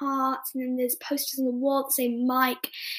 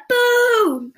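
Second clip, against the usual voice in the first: high, loud mock boo; crowd booing; 11 semitones higher, 7 dB louder than usual. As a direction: boo the dead screen. Crowd boos as movie stops.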